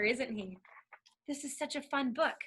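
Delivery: ordinary talk, nothing marked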